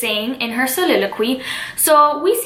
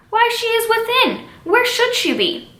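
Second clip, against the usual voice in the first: much higher, indignant Nurse reply; 10 semitones higher than usual. Acted. Nurse answers Juliet about her mother, indignant. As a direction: answer as if the question is absurd, pitched high.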